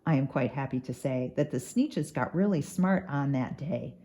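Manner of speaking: ordinary delivery, nothing marked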